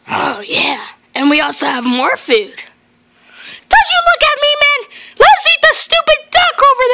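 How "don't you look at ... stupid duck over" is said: high-pitched cartoon voice, indignant; squeaky toy character demanding.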